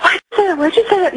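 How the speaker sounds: high, panicked pleading with a nervous laugh; frantic daughter. Retold or imitated. Teen daughter freaking out at her mother's words, high panicked voice. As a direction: voice the scared teen daughter losing it. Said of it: "she was just terrified".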